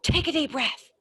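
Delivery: high, hammed-up instruction to breathe; mock telling someone to calm down. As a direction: mimic an annoying helper. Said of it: "When I get told".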